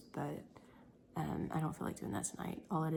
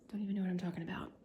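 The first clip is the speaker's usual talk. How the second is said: ordinary talk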